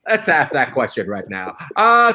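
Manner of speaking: usual speaking voice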